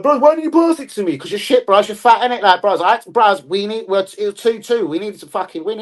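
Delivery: mock annoyed teammate. Teammates squabbling over a missed pass, mock annoyed. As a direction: argue back like an aggrieved player. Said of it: "They're like".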